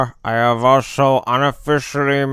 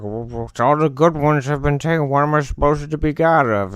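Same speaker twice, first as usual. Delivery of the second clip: fast, higher-pitched complaint; acted protest; 6 semitones higher, faster than usual. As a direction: object in a rush, pitch up. Retells his protest to the gods.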